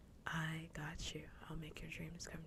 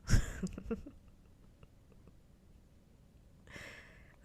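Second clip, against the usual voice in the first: hushed giggling burst; breathy laugh; 6 semitones higher, 4 dB louder than usual. Real, not acted. Streamer amused by own rant, breathy laugh.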